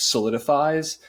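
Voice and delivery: ordinary talk, nothing marked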